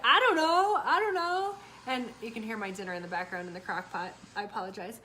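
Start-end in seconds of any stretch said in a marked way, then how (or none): 0.1-1.6 much higher, much louder whiny shout; son whining back. Retold or imitated. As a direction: shrug it off loudly. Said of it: "he's like".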